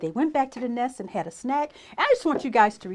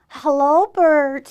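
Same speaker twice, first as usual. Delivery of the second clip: voiced Beaver; 4 semitones higher, 9 dB louder, much slower than usual. A narrator's reading. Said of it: "said Beaver".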